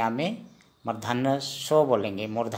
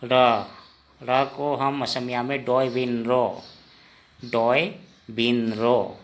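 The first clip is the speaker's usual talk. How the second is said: usual speaking voice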